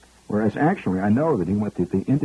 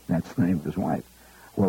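ordinary talk

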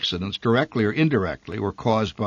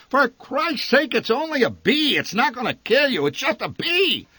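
exasperated shout. A narrator's reading. Father yelling at shrieking wife about a bee, exasperated shout. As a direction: shout her down over a mere bee. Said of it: "The old man shouted above her shrieks".

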